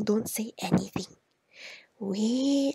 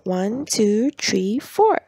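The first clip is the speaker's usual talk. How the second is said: four jumps much higher and louder at the end; four punched high; 5 dB louder, much slower than usual. Shown as a demonstration. Parent counting with child, punching four high and loud. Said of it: "emphasize on the number four".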